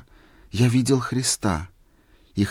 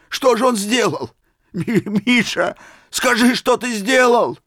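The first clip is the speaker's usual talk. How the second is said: much higher, loud, stumbling with excitement; eager pressing; 12 semitones higher, 4 dB louder than usual. A narrator's reading. Old man urging Misha to tell what he did. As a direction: demand the answer breathlessly. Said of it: "закричал, вскакивая".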